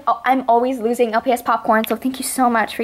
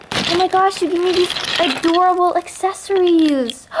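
ordinary talk

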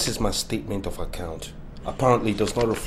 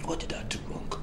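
hurt whisper; 6 semitones higher, 10 dB quieter than usual. Acted. Son hurt.

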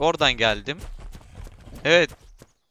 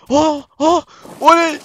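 excited cheer; 11 semitones higher, 10 dB louder, slower than usual. Real, not acted. Good drop in game, cheering.

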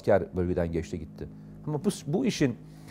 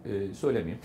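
normal talking voice

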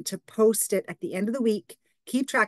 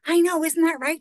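higher and louder, eager; eager agreement; 8 semitones higher, 5 dB louder than usual. Retold or imitated. Clerk agreeing with the customer, higher and eager. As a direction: jump in to agree with her. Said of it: "She goes".

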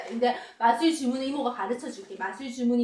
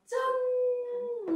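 magic reveal; 10 semitones higher, 5 dB quieter, much slower than usual. Acted.

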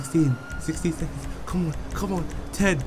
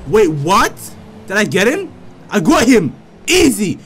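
loud triumphant shouts; excited cheering; 2 semitones lower, 6 dB louder than usual. Real, not acted. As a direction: celebrate the win loudly. Unexpected kill, celebrating.

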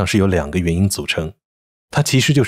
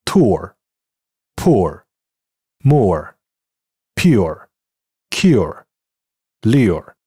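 American tour, poor, more with r-coloring; American English; much slower than usual. Shown as a demonstration. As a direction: rhotic American vowels, teacher demo. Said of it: "美音". (美式发音示范)

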